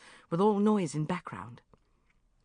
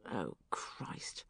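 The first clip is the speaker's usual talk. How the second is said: softer, voiceless groan of dread; dismayed whisper; 8 semitones lower, 12 dB quieter than usual. Acted. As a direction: breathe it out in despair. Dismay at Mum's plan.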